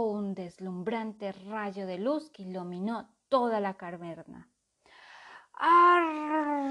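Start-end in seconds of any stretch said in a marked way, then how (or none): 5.5-6.7 high, loud roaring cry; shout. A narrator's reading. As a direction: dragon cries out. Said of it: "gritó Colmillo Feroz".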